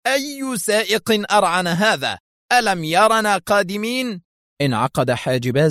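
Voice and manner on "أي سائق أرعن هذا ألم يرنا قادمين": exasperated cry. Acted. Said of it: "فهتف في حنق".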